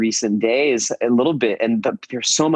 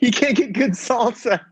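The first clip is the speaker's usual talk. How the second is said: high amused complaint; 12 semitones higher than usual. Real, not acted. Laughing complaint about salsa.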